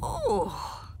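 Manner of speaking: soft sigh of relief. A narrator's reading. Don Diego after the soft landing, sighing. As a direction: let out a quiet sighing "oh". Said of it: "sighed Don Diego".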